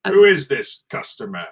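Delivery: drawn-out London-ish drawl, a bit posh; slow plummy drawl. Acted. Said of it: "Does that sort of drawl".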